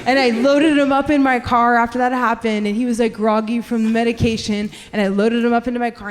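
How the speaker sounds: normal talking voice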